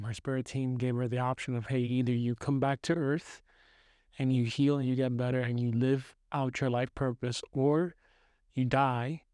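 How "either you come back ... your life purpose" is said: ordinary delivery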